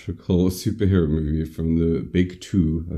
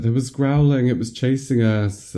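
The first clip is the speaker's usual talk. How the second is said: high, louder imitation of panic; mock frightened babbling; 8 semitones higher, 4 dB louder than usual. Podcaster voices patient babbling about attack, mock panic. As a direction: blurt it as the alarmed girl. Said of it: "like, babbling about what happened".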